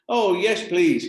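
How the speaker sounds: high, loud, bursting reply; eager yes. Acted. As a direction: jump at the chance, thrilled. Eager boy accepts the job.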